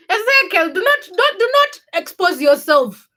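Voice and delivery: high, loud mock-panicked scold; telling Ezekiel off, high and loud; alarmed scolding. Real, not acted. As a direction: cry out to stop him, pitch jumping high.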